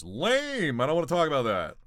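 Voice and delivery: loud, scornful brush-off; mock disgust. Real, not acted. Streamer rejecting a boring chat question, loud mock scorn.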